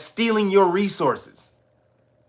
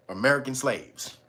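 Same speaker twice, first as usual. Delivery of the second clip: ordinary talk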